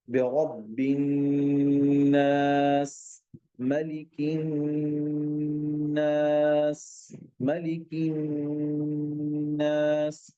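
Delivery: slow drawn-out recitation; slow tajweed chant; Quran recitation. Shown as a demonstration. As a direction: recite slowly, holding each नास. Continues recitation demo.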